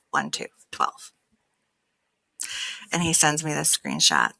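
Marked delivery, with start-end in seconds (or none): 0.1-1.1 barely voiced, breathy count of the numbers; whispered count. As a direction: breathe it out almost silently. Reading number quietly.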